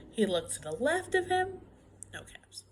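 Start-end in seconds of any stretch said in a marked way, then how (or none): 2.1-2.6 hushed near-whisper; hushed discovery. A narrator's reading. Storyteller revealing the missing caps, hushed. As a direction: drop to a whisper, much softer.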